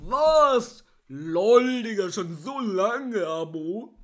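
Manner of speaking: loud hammed-up shocked fan; mock outrage, loud, drawn out; mock shocked viewer. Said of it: "denkst du so".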